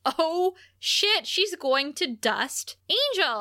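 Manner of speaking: high, sharp startled curse; jolted by the cliffhanger; sudden high alarm; alarmed surprise. Real, not acted. Reacting to Angel's danger.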